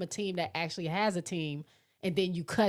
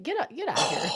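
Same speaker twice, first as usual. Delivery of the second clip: mock get out; 9 semitones higher, faster than usual. Real, not acted. Laughing at Ghost's joke.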